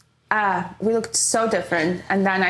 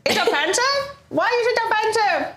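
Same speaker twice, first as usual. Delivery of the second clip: mock innocent; 10 semitones higher than usual. Teasing friend, feigned innocence.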